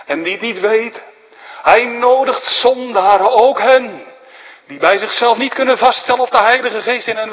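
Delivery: a rising, urgent sermon climax; preacher crying out that he invites all sinners; preaching at full force. Acted.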